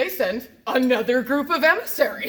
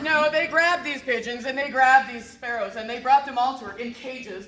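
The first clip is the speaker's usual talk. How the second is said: ordinary delivery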